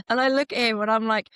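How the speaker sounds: normal talking voice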